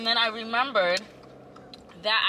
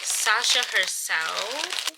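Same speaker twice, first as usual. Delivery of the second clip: slow grand intro; much slower than usual. Real, not acted. Announcing Sasha.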